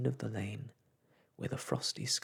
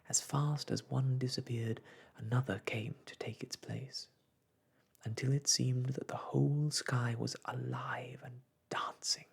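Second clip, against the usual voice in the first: ordinary delivery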